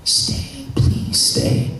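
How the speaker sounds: hushed, breaking plea; acted plea. Flint begging the girl to stay, acted hushed plea.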